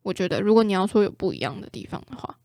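ordinary talk

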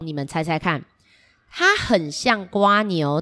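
usual speaking voice

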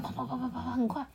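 quick rattling heartbeat demo; fast heartbeat. Shown as a demonstration. Showing the child how fast the heart beats, fast.

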